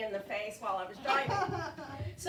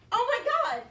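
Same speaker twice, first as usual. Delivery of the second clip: high, loud mock alarm; 11 semitones higher, 7 dB louder than usual. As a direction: exclaim it in acted dismay. Alarm at leaking car.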